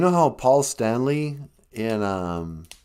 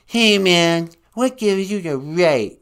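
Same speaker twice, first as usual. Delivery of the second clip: loud, put-on caller voice demanding; indignant caller shouting; angry caller Van Gogh; 3 semitones higher, 6 dB louder than usual. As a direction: confront the host as an irate artist.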